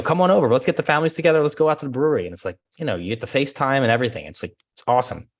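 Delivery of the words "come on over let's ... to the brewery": much higher, louder friendly invite; eager invitation. Retold or imitated. Client texting an invite, much higher and louder. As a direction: invite them over warmly and keen. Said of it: "I had several text messages".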